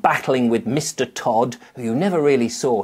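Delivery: ordinary delivery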